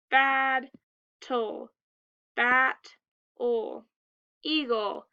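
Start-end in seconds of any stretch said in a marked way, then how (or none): 0.1-3.8 "Bad", "tol", "bat", "ol" as separate beats; split into syllables. Shown as a demonstration. Teacher splitting between consonants, syllable by syllable. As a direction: punch each first syllable, drop on the ending. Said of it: "We split in the consonants".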